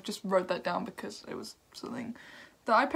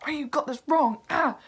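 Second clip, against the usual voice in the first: higher, louder imitation of angry viewers; mock yell; 6 semitones higher, 6 dB louder than usual. Reviewer imitates viewers yelling corrections at him, mock yell. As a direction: yell a correction as a mocking impression. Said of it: "just really yell it in my face".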